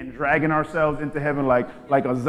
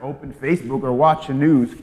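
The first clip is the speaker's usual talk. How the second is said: ordinary talk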